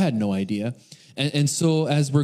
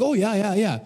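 high, quick bluffing agreement; over-eager, higher-pitched yeah-yeahs; feigned understanding; 7 semitones higher than usual. Retold or imitated. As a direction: pretend you know exactly what's going on. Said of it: "I'm just pretending to know what's going on".

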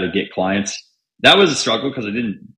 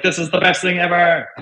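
excited; 10 semitones higher than usual. Retold or imitated. Discovering copywriting, thrilled.

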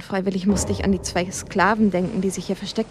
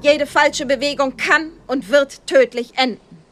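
much higher, loud police command; commanding shout; 6 semitones higher, 6 dB louder than usual. Acted. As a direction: bark orders at the suspects. Agent bursts out, commanding surrender.

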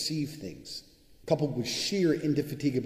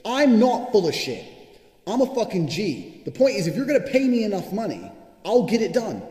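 indignant shouting; 9 semitones higher, 9 dB louder than usual. Real, not acted. Answering people who laugh at his card, shouting. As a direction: yell it back at the doubters.

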